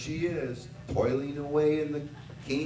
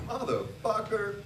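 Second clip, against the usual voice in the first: higher, cocky boast; smug brag; 5 semitones higher, 3 dB louder than usual. Retold or imitated. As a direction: puff yourself up. Smug inner thought.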